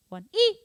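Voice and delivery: "E" punched high and loud; accent at the end. Shown as a demonstration. Drum teacher showing accent at the end, loud "E". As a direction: hit the "E" of "one E". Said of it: "accent at the end".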